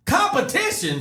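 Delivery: shocked disbelief. Retold or imitated. Shocked at surprise contest.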